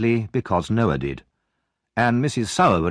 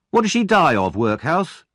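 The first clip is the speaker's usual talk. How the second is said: taunting bully; 7 semitones higher, 3 dB louder than usual. Acted. Noah keeps taunting.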